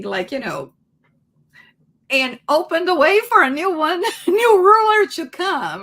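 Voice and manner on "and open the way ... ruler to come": laughing. Real, not acted. Laughing while explaining lyrics.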